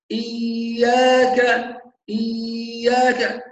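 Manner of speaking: إياك with the ya drawn out very slowly like a long vowel; overstretched ya. Shown as a demonstration. Teacher demonstrates overstretching the ya into a long vowel. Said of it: "كده خلاها حرف مد".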